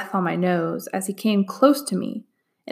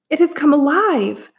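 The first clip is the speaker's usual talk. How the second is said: shocked gasp, higher and louder; 7 semitones higher, 4 dB louder than usual. A narrator's reading. Magistrate gasps as the painted dragon comes alive. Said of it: "I heard him gasp".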